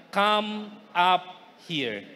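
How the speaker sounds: slow invitation. Acted. Preacher savoring the invitation.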